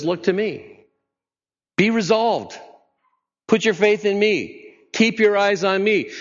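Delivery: lifted high, earnest call; high pleading preacher's voice; urgent plea. Acted. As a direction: reach out to the troubled heart. Voicing Jesus' call to listeners.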